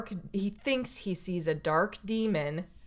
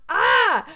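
loud, higher mock scream "Ah"; kid's scream; 6 semitones higher, 8 dB louder than usual. Retelling the kid's fright, mock yell. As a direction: yell "Ah!" like the scared kid. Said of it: "the kid goes".

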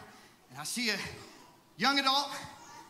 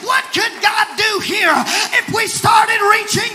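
loud preacher's cry, climbing higher; full-force preaching; 7 semitones higher, 14 dB louder than usual. Real, not acted. Preacher asks the church what God could do, rousing shout. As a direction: build the questions to a shouted peak.

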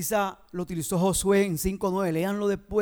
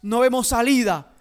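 loud, raised, impassioned sermon voice; fiery preaching; 7 semitones higher, 11 dB louder than usual. Acted. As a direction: preach it out with rising force. Preaching continues at full force. (predicación encendida y fuerte)

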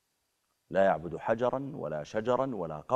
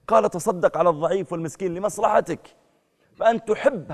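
raised, high, loud sermon voice; preacher denouncing a false convert, loud and high; fiery preaching; 6 semitones higher, 6 dB louder than usual. Acted. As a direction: drive the point home to the crowd. Preaching at full force.

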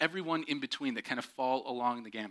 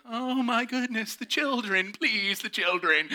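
high, slow scandalized plea; mock horror; 9 semitones higher, 4 dB louder, slower than usual. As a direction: clutch your pearls. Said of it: "I was like".

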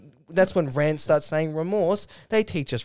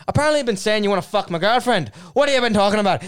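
rapid, raised mock challenge; acted confrontation; 6 semitones higher, 4 dB louder, faster than usual. Caller confronting Wayne about his girlfriend, acted anger. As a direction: confront him loudly, pitched up.

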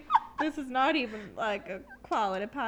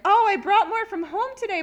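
higher, louder eager announcement; mock excitement; 9 semitones higher, 8 dB louder than usual. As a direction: announce it eagerly, imitating yourself. Retells own eager announcement.

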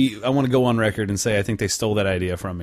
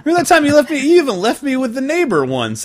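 much higher and louder, hammed-up whine; mock shrill accusation; 7 dB louder than usual. Teen loudly accusing his mom of leaving him, hammed up. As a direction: accuse like a wronged kid, pitched way up.

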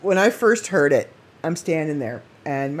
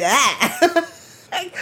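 flustered laugh; 11 semitones higher, 3 dB louder than usual. Real, not acted. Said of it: "you can hear me".

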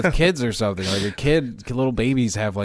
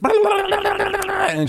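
laughing. Real, not acted. Laughing while retelling.

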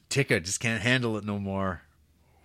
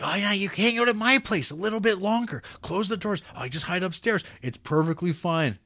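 mock welcome; 10 semitones higher, 3 dB louder than usual. Mocking characters' false welcome.

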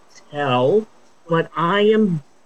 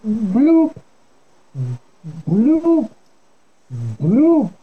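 bubbly bloop noise, higher pitch; underwater bloop; 4 semitones higher, much slower than usual. Pretend. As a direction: pop out a silly underwater bloop. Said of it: "I sound like I'm underwater".

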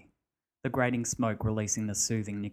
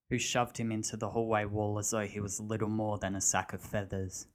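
usual speaking voice

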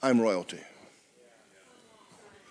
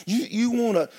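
ordinary talk, nothing marked

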